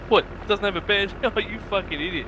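high mocking laugh. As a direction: laugh at him as you mock. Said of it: "He goes".

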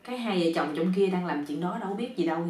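ordinary delivery, nothing marked

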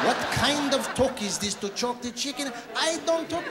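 high, indignant protest; mock outrage. Comedian objecting to his own vulgar phrase, mock outrage.

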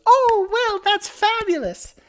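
very high, loud gushing bookseller; delighted shopkeeper. Acted. Bookseller hears the customer is excited, gushing high delight. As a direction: squeal it with theatrical delight. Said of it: "And she goes".

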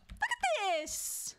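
very slow, high, drawn-out scolding; high mock-indignant wail, each word dragged; exaggerated mock outrage. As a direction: stretch it out in appalled disbelief. Mock telling-off, repeated for emphasis.

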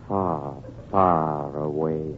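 low, soft and very slow; eerie hush. Acted. Sanitarium owner luring patient to a lonely spot, eerie hush. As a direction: let each word sink away into the distance.

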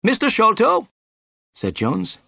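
high-pitched, emphatic inspector; pompous policeman making an arrest; stern inspector. A narrator's reading. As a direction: pronounce it officially in a high character voice.

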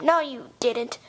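normal talking voice

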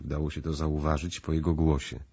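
ordinary delivery

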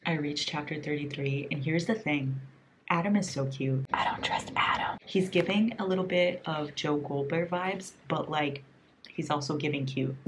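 soft, low late-night murmur; hushed. Real, not acted. Reader giving a chapter thirty-three update, hushed voice. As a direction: keep it hushed and confiding.

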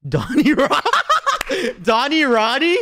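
giggling through the name, pitch jumping up; laughing at the name. Real, not acted. Streamer laughing at the rabbit's silly name, laughing. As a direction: crack up while saying it.

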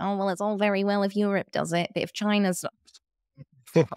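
high-pitched mock whataboutery voice; put-on high voice sending up the argument; mocking objector. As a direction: parody a dismissive objector, pitched well up. Mimicking the pushback, then cracks up laughing.

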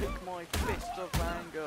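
loud, slow, hammed-up threat; slow mock-angry shout; mock battle cry. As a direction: declare it like a playful war cry. Punching a door in game.

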